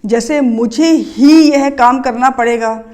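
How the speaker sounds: ही pressed a little firmer, sentence paced slowly; stress on ही. Shown as a demonstration. Teacher demonstrating stress on ही to students. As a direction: lean on the word ही as a teaching example. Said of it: "किस word पर हमने stress डाला है? ही पर।".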